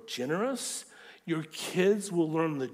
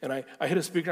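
normal talking voice

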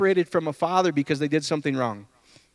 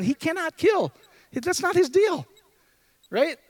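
much higher, impassioned declaration; fervent preaching; 11 semitones higher, slower than usual. Real, not acted. Pastor insisting God cannot kill, impassioned and high. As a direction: preach it with rising passion.

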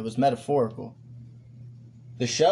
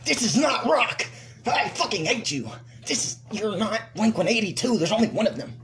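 higher, mock jeering fan; angry crowd; 7 semitones higher than usual. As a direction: jeer like a fed-up fan. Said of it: "What if they just dragged him off".

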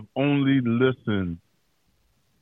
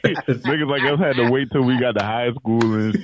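ordinary talk, nothing marked

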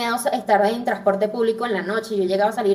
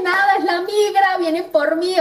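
mild retold alarm, higher pitched; retold panic; 7 semitones higher than usual. Woman tells herself immigration is after her, mild panic. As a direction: relive the scare with raised pitch. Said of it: "imagínate mi miedo".

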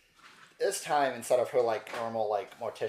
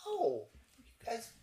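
much higher, sing-song teasing; Riza teasing; 13 semitones higher than usual. Acted. Riza teasing the players about their new friend, high and cooing. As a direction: coo it up high like a delighted hostess. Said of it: "she goes".

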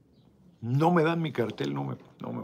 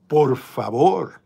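scornful drawl; 3 semitones higher, 10 dB louder, much slower than usual. Retold or imitated. Desafío burlón ante quitar la visa.

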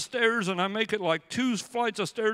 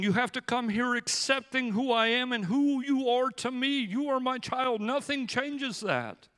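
God reassuring; 6 semitones higher than usual. Acted. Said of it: "God is going".

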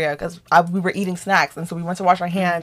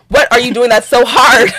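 louder, higher incredulous demand; disrespectful challenge; 4 semitones higher, 7 dB louder than usual. Retold or imitated. Challenging undeclared roommate in retold quarrel, loud and disrespectful. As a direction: throw it out as a scornful challenge. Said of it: "I was low-key being disrespectful".

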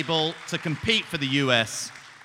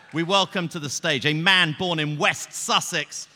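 building announcer call, ending in a loud shout; mock announcer intro; 4 semitones higher, 4 dB louder than usual. Host announcing the guest's grand entrance, building to a shout. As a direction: hype the guest onto the stage like a ring announcer.

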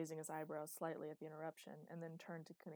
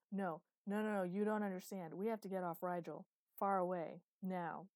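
loud, urgent plea to flee; urgent insistence; 7 dB louder than usual. Acted. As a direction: press the point hard and loud. Frightened Luke urging escape.

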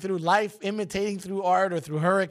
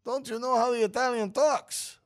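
put-on higher-pitched, hammy Italian accent; 8 semitones higher than usual. Host schooling his co-host on how Italians talk, in mock Italian accent. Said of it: "You're doing better".

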